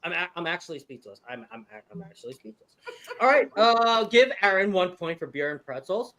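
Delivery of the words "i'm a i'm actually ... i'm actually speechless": very soft, stunned delivery; speechless. Real, not acted. Host reacting to a player's answer, speechless and very quiet. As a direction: say it barely aloud, lost for words. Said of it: "I'm speechless".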